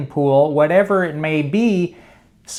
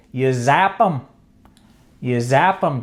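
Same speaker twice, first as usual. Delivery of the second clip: ordinary talk, nothing marked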